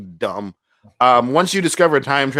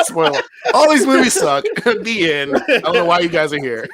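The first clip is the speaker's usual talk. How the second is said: mock-announce the ending through laughs; joking podcast outro through laughter; laughing mock outro; 5 semitones higher than usual. Real, not acted. Joking spoiler bit.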